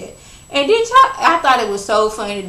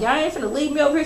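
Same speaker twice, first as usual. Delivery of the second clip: rushed panic; 6 semitones higher, much faster than usual. Retold or imitated. Candy refuses to be left alone.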